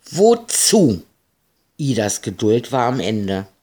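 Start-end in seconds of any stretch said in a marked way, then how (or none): 0.1-1.0 high, loud irritated question; cross. Acted. One sister questioning the other, cross. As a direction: fire it back, annoyed. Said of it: "Marie wurde sauer".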